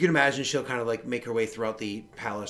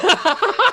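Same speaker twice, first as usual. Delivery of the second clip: high startled laugh; relieved laughter; 10 dB louder than usual. Real, not acted. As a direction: burst out laughing from shock. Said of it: "that scared the shit out of me".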